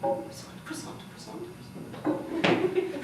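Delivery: very soft practice under the breath; muttered rehearsal. Acted. Tourist practising before ordering a croissant, muttered rehearsal. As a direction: quietly try the word out to yourself.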